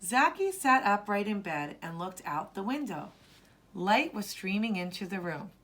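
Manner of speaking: usual speaking voice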